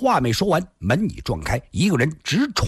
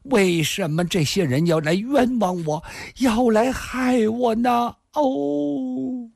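very high, wailing woman's sobs; bitter weeping; 14 semitones higher than usual. A narrator's reading. Woman hiding her face, wailing that she is wronged. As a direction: wail the questions through sobs. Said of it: "林仙儿突然掩面痛哭起来".

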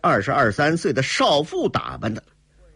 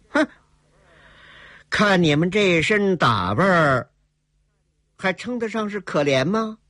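higher-pitched scornful question after a scoffing hmph; cold sneer; 8 semitones higher than usual. A narrator's reading. Heroine doubting the women's pitiful claim, cold sneer. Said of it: "冷冷一笑".

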